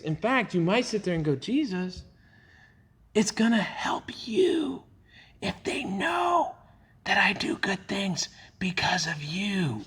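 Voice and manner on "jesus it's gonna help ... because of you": slow, secretive whisper to Jesus; conspiratorial whisper. Pretend. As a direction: hush it like a sly secret.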